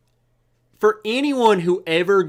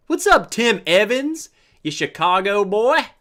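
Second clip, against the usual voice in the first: higher, louder mock Chicago accent; put-on Chicago accent; 5 semitones higher, 4 dB louder than usual. Host greeting a Chicago viewer, put-on Chicago accent. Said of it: "why I gave you that accent".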